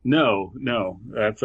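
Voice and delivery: usual speaking voice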